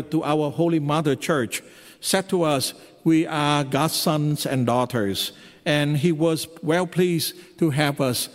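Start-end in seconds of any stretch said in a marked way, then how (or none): none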